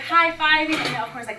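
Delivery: normal talking voice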